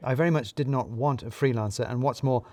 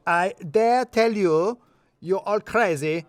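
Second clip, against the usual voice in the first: put-on slow, much higher, louder accented shout; full volume; 8 semitones higher, 5 dB louder, slower than usual. A narrator's reading. Radu storms out of the office, loud accented shout. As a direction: bellow it in a thick accent. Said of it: "heard him at full volume".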